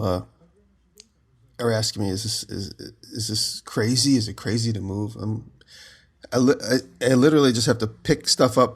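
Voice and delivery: ordinary delivery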